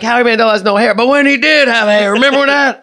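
high, loud hammed-up arguing; mock Queens accent. Host acting a silly argument about Howie, mock Queens accent. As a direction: argue it in broad mock Queens. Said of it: "I'm like".

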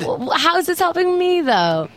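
high, exasperated complaint. Retold or imitated. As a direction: complain in disbelief. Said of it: "and I'm like".

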